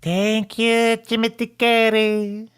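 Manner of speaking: high, drawn-out mock New Jersey accent; weird New Jersey accent. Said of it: "that's a weird accent".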